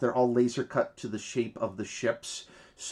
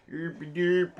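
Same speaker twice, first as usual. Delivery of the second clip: higher-pitched nonsense gibberish in mock Swedish; Swedish Chef; 6 semitones higher than usual. Co-host names the Muppet by imitating him, mock-Swedish babble. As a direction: babble it in a silly Scandinavian lilt. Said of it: "yeah, the Swedish chef".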